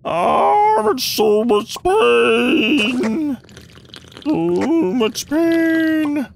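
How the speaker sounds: high, slow, wailing moan of pain; mock pain. Hurt in the game, mock wailing pain. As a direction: ham up being in agony. Hammed-up pain while getting hit.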